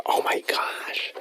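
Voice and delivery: slow, hushed whisper; whispered dismay. Acted. Host unsure how listeners are reacting, dismayed whisper. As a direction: whisper it slowly, as an aside to yourself.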